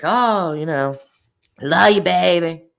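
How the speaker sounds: a hint of a gushing fan; imitating a donor. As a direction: read it like a viewer's sweet super chat. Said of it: "they'll say something".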